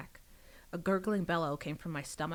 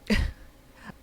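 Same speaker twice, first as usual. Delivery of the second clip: burst of laughter; 8 dB louder than usual. Real, not acted. Reader laughs at the story.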